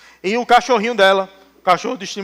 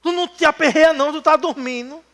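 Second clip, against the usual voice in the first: panicked disciples; 7 semitones higher than usual. Retold or imitated. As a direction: wake him in a panic. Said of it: "Mas quando".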